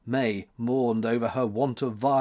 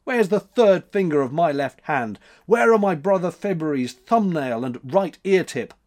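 loud, raised demand; blustering shout; 5 semitones higher, 5 dB louder than usual. A narrator's reading. March demands his missing parts, blustering and shouting. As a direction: bluster loudly at the culprit. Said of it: "shouted March, a blustering fellow".

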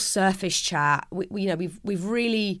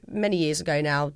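ordinary talk

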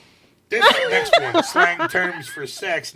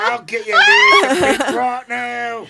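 hammed-up British lass voice, higher and louder toward the end; mock British lass; 13 semitones higher, 10 dB louder, slower than usual. Mock British lass answering a stranger in the street, hammed-up and laughing. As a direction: play a cheeky British lass agreeing, laughing. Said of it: "and she's like".

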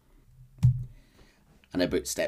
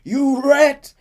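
rising, louder scream of the insult; 11 dB louder, much slower than usual. Retold or imitated. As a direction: yell it, building. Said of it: "Arthur's screaming at him".

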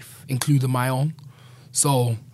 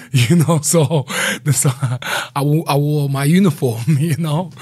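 loud words broken by real laughter; cracking up mid-sentence; laughing while talking; 3 semitones higher, 7 dB louder than usual. Real, not acted. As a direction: laugh your way through the explanation. Laughs while explaining his outfit.

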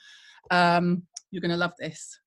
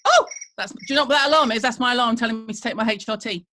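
mock alarm excuse; 4 dB louder, faster than usual. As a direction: blurt out the alarm excuse in a hurry. Escaping with a fake excuse.

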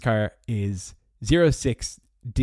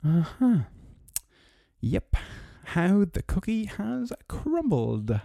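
sarcastic; 8 semitones higher, much slower than usual. Acted. Sarcastic closing remark.

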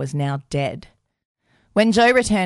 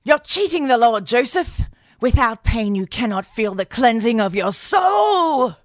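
scolding aunt; 6 semitones higher than usual. Retold or imitated. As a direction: berate the boy like a zealous aunt. Aunt scolding Joe in story.

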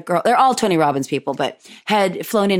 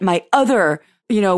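normal talking voice

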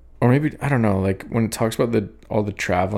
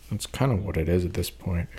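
ordinary talk, nothing marked

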